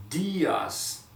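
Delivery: "Dias" with the i held longer; long stressed i. Shown as a demonstration. Teacher stretches the accented i in "Dias". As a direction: lean on and lengthen the i in "Dias". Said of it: "the accent on the I".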